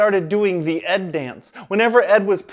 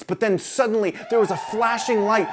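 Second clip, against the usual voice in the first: usual speaking voice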